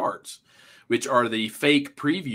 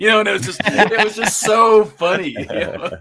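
high, loud, laughing delivery; 10 semitones higher, 9 dB louder than usual. Real, not acted. Recalling a hilarious fake preview to friends, laughing hard. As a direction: crack up while saying it. Cracks up at own retold movie moment.

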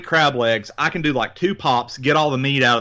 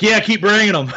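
high, loud eager reply; 10 semitones higher, 5 dB louder than usual. Retold or imitated. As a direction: call for more happily. Quoting self eagerly.

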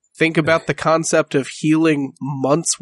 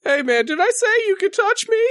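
higher, affronted talking horse; indignant horse voice; faster than usual. Acted. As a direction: play the horse, offended at being touched.

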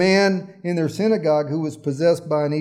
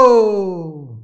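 put-on old lady groaning oh; 2 semitones higher, 7 dB louder than usual. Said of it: "she'd go".

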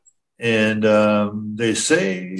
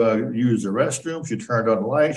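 ordinary talk

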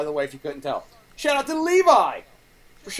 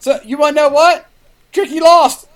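loud, high-pitched taunting announcement; 4 semitones higher, 11 dB louder than usual. Real, not acted. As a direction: call out the gotcha, loud and high. Taunting co-host about losing.

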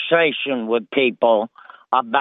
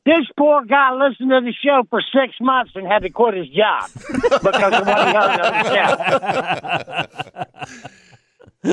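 chuckling through the words, slowing at the end; laughing while telling; 3 semitones higher than usual. Real, not acted. Host cracking up while telling co-hosts the story. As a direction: let the laugh break into the story.

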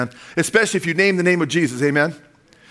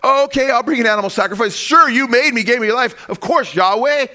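Cain pictured, higher and louder, eagerly agreeing; a hammed-up Cain keenly saying yes; mock Cain; 7 semitones higher, 4 dB louder than usual. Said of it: "Can you imagine if Cain did that?".

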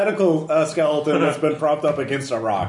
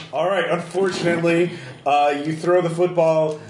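ordinary talk, nothing marked

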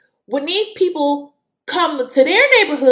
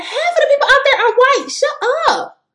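exasperated retort; 12 semitones higher than usual. Real, not acted. Retort to politicians.

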